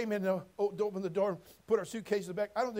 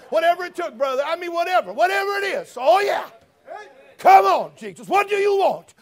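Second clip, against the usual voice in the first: higher, louder preacher's shout; preaching at full force; 8 semitones higher, 14 dB louder than usual. Acted. Preacher to congregation about Jesus spat on, shouting. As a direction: hammer each phrase from the pulpit.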